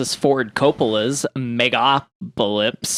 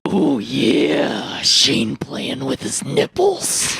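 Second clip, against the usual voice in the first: wrestling promo voice; much slower than usual. Pretend.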